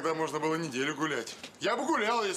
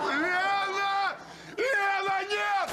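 desperate shout; 14 semitones higher, much slower than usual. Acted. Pleading shout at Lena.

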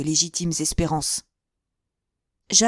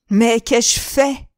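high, loud, slow anguished cry; cry of rage; 5 semitones higher, 6 dB louder, much slower than usual. A narrator's reading. Imprisoned son crying out in rage, high and slow. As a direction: drag out the cry in sudden rage. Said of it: "s'écria-t-il".

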